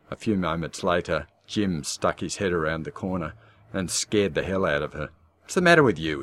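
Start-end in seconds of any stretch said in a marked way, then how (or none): 5.4-6.2 loud, higher and irritated; cross. Acted. Husband scolding wife for screaming, cross. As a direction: bark it at her crossly. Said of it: "he said crossly".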